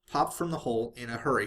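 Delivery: usual speaking voice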